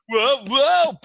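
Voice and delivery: mock alarmed high, loud yelps; startled stumble. Player acts out fumbling the gun shot, startled. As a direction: yelp as if losing your balance.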